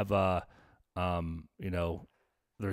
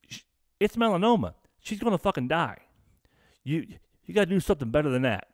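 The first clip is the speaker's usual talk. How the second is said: higher, fast insistent retold plea; urgent pleading; 7 semitones higher, 3 dB louder, faster than usual. Urging his dad to do better, hurried and insistent. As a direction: press the point, pitch up and rushing.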